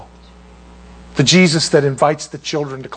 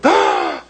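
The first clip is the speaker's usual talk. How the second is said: mock gasp; 14 semitones lower than usual.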